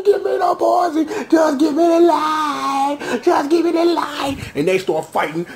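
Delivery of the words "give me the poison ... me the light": high, yelling hammed-up rage; mock furious Hazel. Viewer acting out Hazel's rage at the fight, high mock yell. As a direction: shout it like a grieving brawler. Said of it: "Hazel like".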